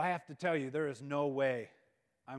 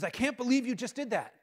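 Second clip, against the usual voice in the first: higher-pitched mock reproach; angry reproach; 6 semitones higher, 4 dB louder than usual. Girlfriend confronting runner after the race, mildly angry. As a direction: retell her mild angry outburst. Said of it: "she looks angry".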